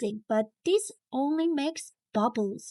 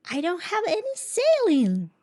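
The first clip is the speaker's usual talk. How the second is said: put-on worried child; 6 semitones higher, 3 dB louder than usual. A narrator's reading. Child panics over forgotten saline.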